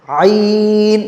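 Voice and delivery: "आइन" from the throat, with a long held vowel; pronunciation demo. Shown as a demonstration. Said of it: "हलक से". ("आइन" हलक से खींचकर)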